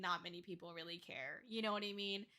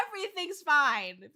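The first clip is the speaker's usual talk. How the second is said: raised, loud mock-cheerful mask; fake everything's-fine cheer; 5 semitones higher, 9 dB louder than usual. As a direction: pretend loudly that all is well. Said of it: "everything's fine" mask".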